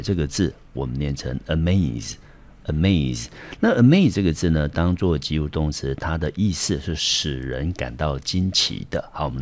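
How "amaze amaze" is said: amaze with the stress on maze, uh-MAYZ; stress on second syllable. Shown as a demonstration. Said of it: "重音节落在第二个音节".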